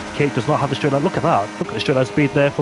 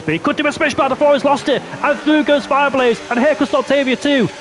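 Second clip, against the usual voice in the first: excited commentary; 8 semitones higher than usual. Real, not acted. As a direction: call the overtakes with rising excitement. Live call, multiple overtakes.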